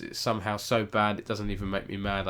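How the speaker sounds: ordinary talk, nothing marked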